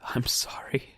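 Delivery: unvoiced, breathy whisper; whispered sorrow to her dead dog; whispered apology. A narrator's reading. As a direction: whisper the apology over his body. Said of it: "she whispered".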